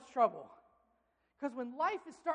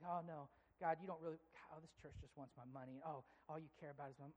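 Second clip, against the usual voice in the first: grumbling under breath; 7 semitones lower, 14 dB quieter than usual. Retold or imitated. Deceptive heart complaining.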